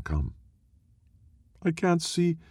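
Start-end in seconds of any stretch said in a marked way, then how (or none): 1.6-2.4 put-on high, pained young girl voice; a scared girl whimpering in the dark; frightened girl. A narrator's reading. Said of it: "Nanda whispers".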